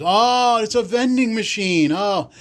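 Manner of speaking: high, loud oh of realization; the penny drops all at once; sudden realization. Retold or imitated. Realizing the gum machine counts as vending, high and loud.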